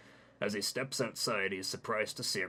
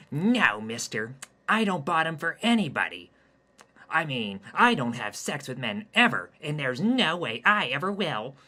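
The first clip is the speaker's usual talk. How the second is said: effeminate pleading man; 7 semitones higher, 13 dB louder than usual. Acted. Hayden pleads with spider.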